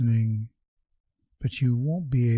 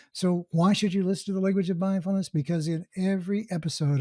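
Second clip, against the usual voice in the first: higher-pitched, lively host announcing the show; podcast intro read; 7 semitones higher than usual. Acted. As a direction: sell the show with upbeat, emphatic energy. Scripted podcast intro over music.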